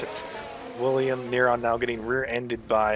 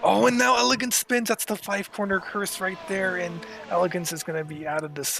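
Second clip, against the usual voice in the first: excited commentary; 9 semitones higher than usual. Real, not acted. Commentator calls a spin.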